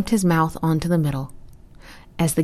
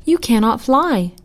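higher, emphatic objection; incredulous objection; 6 semitones higher, 4 dB louder than usual. A narrator's reading. Geese object to the flightless turtle, incredulous. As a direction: point it out in a raised pitch.

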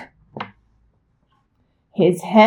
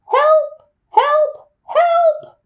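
high, small cries for help; little truck calling out for rescue; high-pitched storybook distress; crying for help; much slower than usual. A narrator's reading. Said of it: "cried the little blue truck".

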